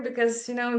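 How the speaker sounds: ordinary talk, nothing marked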